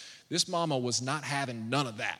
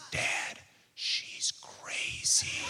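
very slow, soft whispered aside; 5 dB quieter, much slower than usual. Retold or imitated. Son whispering to his dad about the mom, whispered. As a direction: whisper it wide-eyed to dad. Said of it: "And he says".

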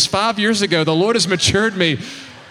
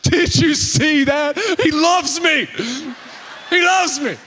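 thrilled gushing; 6 semitones higher, slower than usual. Retold or imitated. A fan raving to friends after his hero nods, giddy. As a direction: gush like a kid whose hero noticed him.

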